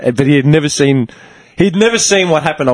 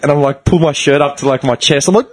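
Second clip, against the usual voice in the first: ordinary talk, nothing marked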